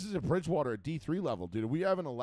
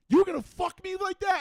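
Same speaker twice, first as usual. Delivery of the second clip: much higher and louder, disbelieving; outraged yell; 8 dB louder than usual. Retold or imitated. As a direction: shriek it like a betrayed teammate. Betrayed teammate yells in retelling.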